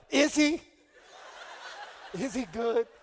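loud, laughing mock lament; hammed-up dying prayer; mock despairing shout. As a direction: cry it out like a doomed man. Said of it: "I'm like".

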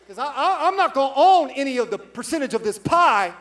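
loud, high stammering protest; hammed-up refusal to take any blame; mock defensive refusal. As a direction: play up the stubborn indignation. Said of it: "do you know what I wanted to say".

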